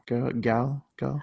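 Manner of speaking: put-on British-accented "girl" sliding toward "gal"; lightly put-on British "girl". Shown as a demonstration. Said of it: "the British accent of girl".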